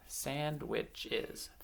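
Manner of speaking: "sandwiches" softly split into three beats; syllable by syllable. Shown as a demonstration. Teacher counting syllables along with children, syllable by syllable. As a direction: count off "sandwiches" quietly, beat by beat. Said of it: "count the syllables".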